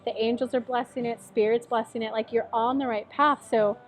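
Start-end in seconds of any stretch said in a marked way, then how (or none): none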